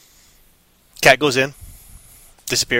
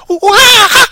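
piercing high monster shriek; ear-splitting high monkey scream; monkey screech; 11 dB louder than usual. Acted. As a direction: screech like a wild flying monkey. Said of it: "And the monkey goes".

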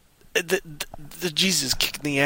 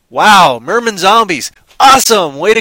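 loud, higher sarcastic wow; mock excitement; 6 semitones higher, 12 dB louder than usual. Real, not acted. Describing merman zombies to co-host, loud mock thrill. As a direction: sell the absurd twist with loud glee.

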